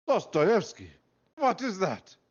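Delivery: put-on high, loud shocked Russian accent; shocked Russian exclamation. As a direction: gasp it out in a hammed-up Russian accent. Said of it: "the Russian accent there".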